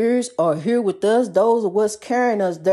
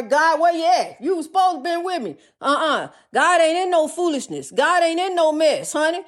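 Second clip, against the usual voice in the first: high sassy preaching; 7 semitones higher than usual. Acted. High pitched mock plea and scolding.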